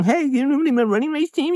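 hammed-up nagging coach asking; mocking pushy coach.

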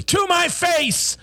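controlled start bursting into a high shout; sudden eruption. A narrator's reading. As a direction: erupt on the last words, drawn out and high. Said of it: "John's voice erupted".

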